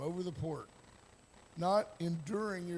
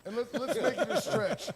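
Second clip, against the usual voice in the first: laughing through the words, higher and louder; giggling while setting up the joke; 6 semitones higher, 6 dB louder than usual. Real, not acted. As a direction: crack up as you say it. Joking about a lunch scenario.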